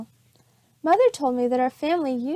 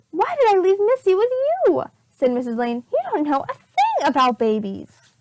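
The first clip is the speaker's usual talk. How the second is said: higher, louder scolding voice; exasperated mother; 6 semitones higher, 4 dB louder than usual. Acted. Missus Lane scolding Amelia, exasperated. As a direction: scold the sitter.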